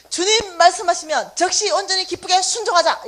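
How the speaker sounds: "순종하자" called out loud and strong; shouted slogan. Shown as a demonstration. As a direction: shout it like a rallying cry. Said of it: "세 번씩 구호를 외치는 거예요".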